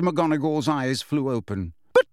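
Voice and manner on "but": high, strained politeness; taut, falsely pleasant; forced smile. A narrator's reading. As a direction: force courtesy through a stiff smile. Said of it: "attempt at a reciprocal smile".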